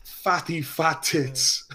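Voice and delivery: slow, drawn-out Dublin taunt; mocking Dublin drawl, stretching each word; McGregor impression. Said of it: "The way he answered it was like".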